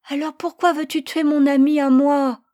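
put-on high, loud pleading little boy; child's voice. Boy begging his parents to spare the lamb, high and loud. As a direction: plead like a small boy defending his pet.

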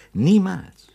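"niemals" thrown up high and loud, then falling; emphatic never. Acted. Investigator insisting to the doctor, "niemals" punched loud and high. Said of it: "Ich sage ausdrücklich".

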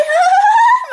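very high mocking cackle; ghostly cackle. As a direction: shriek a ghost laugh. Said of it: "vừa cười cợt mà vừa nói".

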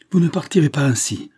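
loud, high-pitched protest; exclamation. A narrator's reading. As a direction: cry out to stop someone leaving. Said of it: "s'écria-t-il". (s'écrier, voix haute et forte)

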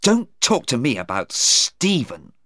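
mildly heated, cut the subject off; angry. A narrator's reading. Sarah to her mother about Stephen, angry. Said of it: "Sarah was angry".

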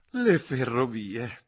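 slow, high, breathless through giggles; gasping with laughter. Acted. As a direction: gasp it out, choking on laughter. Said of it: "ansimò la signorina Levish". (ansimando tra le risate)

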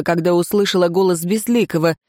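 plain reading voice

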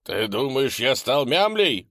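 loud indignant shout. Acted. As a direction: yell back, offended. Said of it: "закричал Декс".

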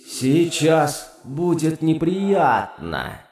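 slow, gloating demon whisper; sadistic demonic whisper. Acted. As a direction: relish the coming pain, slowly. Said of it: "прошептал он с нескрываемым садистским удовольствием".

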